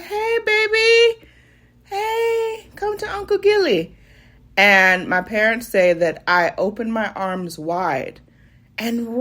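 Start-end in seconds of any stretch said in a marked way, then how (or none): 0.0-4.0 put-on very high, slow cooing at a baby; Uncle Gilly cooing. Uncle Gilly calls a toddler across the room in a very high coo. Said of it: "opens up his arm and said".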